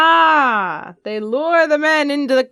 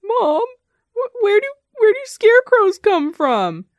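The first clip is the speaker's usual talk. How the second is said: put-on curious child; 8 semitones higher than usual. Child asking mom a question.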